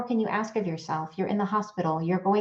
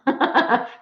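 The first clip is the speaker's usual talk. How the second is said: light laugh; 5 semitones higher than usual. Real, not acted. Laughs at her guides.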